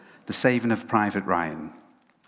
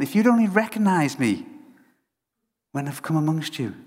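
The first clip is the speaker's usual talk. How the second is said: lamenting Jesus; 3 semitones higher than usual. Retold or imitated. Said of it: "he looked at Jerusalem and he said".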